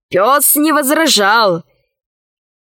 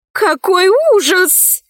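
high, drawn-out cry of dismay; horrified exclamation; 8 semitones higher, slower than usual. A narrator's reading. Dinara reacts to the bird's news, high exclamation. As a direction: exclaim in alarm, pitched up. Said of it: "воскликнула Динара".

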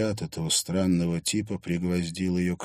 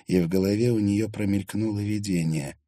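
ordinary delivery, nothing marked

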